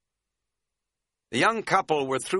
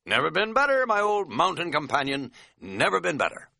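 put-on high, exuberant boast to an old pal; buoyant, hearty and overdone, starting loud and high; exaggeratedly energized; 8 semitones higher, 3 dB louder than usual. Hiker answering his friend's check on him, exaggeratedly energized.

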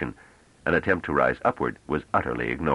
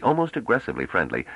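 ordinary talk, nothing marked

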